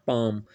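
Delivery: palm said as "paam" with no L sound; silent L. Shown as a demonstration. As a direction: drop the L in palm and stretch the a. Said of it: "एल silent है".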